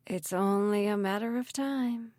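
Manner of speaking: slow, restrained villain voice; gloating menace. A narrator's reading. Mayor gloats as the gas pressure grows, slow menace.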